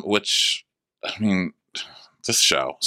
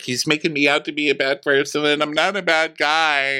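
high mock whine, imitating someone in tears; badly hurt, high-pitched imitation; crying; 7 semitones higher, faster than usual. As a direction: protest it high, as if tearful. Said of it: "Luke is crying now".

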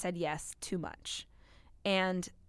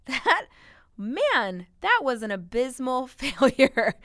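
laughing it off; 4 semitones higher, 6 dB louder, slower than usual. Real, not acted. Laughing at her own failure.

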